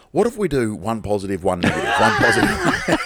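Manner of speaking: laughing, mocking a grumpy old bloke; grumpy old man. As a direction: take him off as a grumpy old grouch. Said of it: "such a fucking old grumpy shit".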